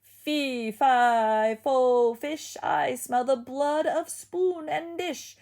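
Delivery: loud slow giant chant; booming giant. A narrator's reading. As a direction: boom it from far away. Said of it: "a voice boomed".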